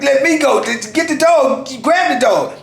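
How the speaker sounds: high, frantic retold plea; acted-out desperate demand; urgent struggle cry. Yelling back while wrestling the dog.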